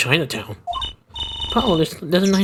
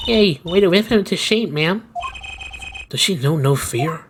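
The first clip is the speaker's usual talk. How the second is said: ordinary delivery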